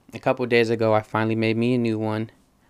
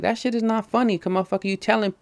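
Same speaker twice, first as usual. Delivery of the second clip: higher, heated retelling of the argument; retold angry outburst; 10 semitones higher than usual. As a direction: replay the fight, voice pitched up and indignant. Arguing with friend, retold.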